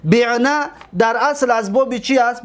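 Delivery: ordinary delivery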